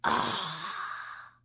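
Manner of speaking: a long soft sigh of relief after a drink; relieved sigh. Acted. Old man relishing the water, soft sigh of relief. Second sip, deep relief.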